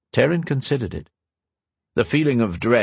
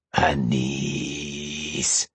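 low, long hissing voice in the dark; drawn-out hiss; 7 semitones lower, 4 dB quieter than usual. Acted. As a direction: stretch the name into a low hiss. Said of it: "The voice was a hiss in the dark, long and drawn out".

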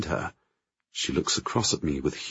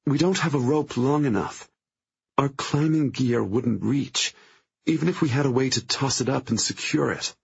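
put-on despairing young woman; 6 semitones higher than usual. A narrator's reading. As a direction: give up hope, voice sinking. Trapped, despairing friend.

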